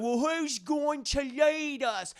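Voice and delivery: high, drawn-out Cockney whine; exaggerated Cockney prisoner; mock Cockney. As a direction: ask it in a hammed-up Cockney accent. Prisoners voiced after Cockney remark.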